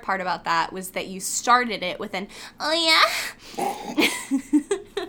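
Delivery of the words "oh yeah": high, loud porn star imitation with a laugh; teasing imitation of a rising moan; mock moan. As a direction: moan it upward like a parody starlet. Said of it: "It's, like, almost a moan".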